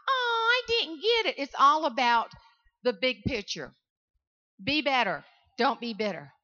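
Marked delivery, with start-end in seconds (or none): 0.1-1.4 whiny little pout on it; mock whine. Mocking a sore loser who did not get picked, mock whine. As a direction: whimper it like a sore loser, hammed-up. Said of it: "The I".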